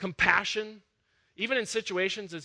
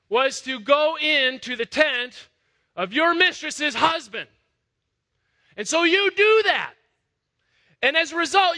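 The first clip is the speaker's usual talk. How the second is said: rising, louder preacher's build; preaching at full force; 5 semitones higher, 5 dB louder than usual. Acted. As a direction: drive the story home to the congregation. Preacher builds Hagar's story.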